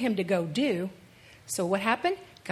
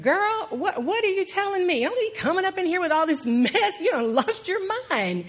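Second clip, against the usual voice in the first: high, scolding disbelief in a sassy voice; incredulous Joseph; 8 semitones higher than usual. Retold or imitated. Preacher voicing Joseph scolding pregnant Mary, high sassy disbelief.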